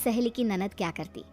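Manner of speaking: ordinary delivery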